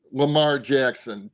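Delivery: ordinary talk, nothing marked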